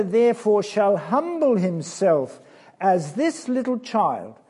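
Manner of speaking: loud, emphatic Bible reading; reading the Bible aloud with force; scripture reading. Acted. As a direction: proclaim the verse to the children. Reads Jesus' words.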